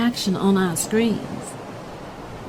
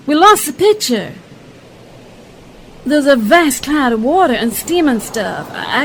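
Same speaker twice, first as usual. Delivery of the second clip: excited live report; 3 semitones higher, 5 dB louder than usual. Acted. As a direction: describe the explosion as it happens.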